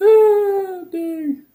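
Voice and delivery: high, loud surprised oh; startled delight at the name; real surprised exclamation; surprised cry. Real, not acted. Sees returning viewer.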